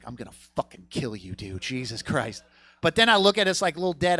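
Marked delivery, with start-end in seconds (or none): 0.0-2.4 muttered threat. Retold or imitated. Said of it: "and I'm like".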